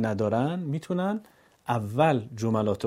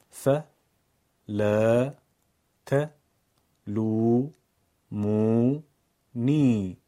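broken into separate syllables ف لا ت لو مو نی; 2 semitones lower, much slower than usual. Shown as a demonstration. Teacher splits فلا تلومونی into syllables. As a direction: pause after every syllable. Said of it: "بخش بخش".

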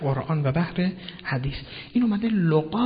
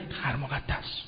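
reluctant; 2 semitones lower, 10 dB quieter, much slower than usual. Real, not acted. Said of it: "جایی که بنده خدا میگفت نمیتونم نگم".

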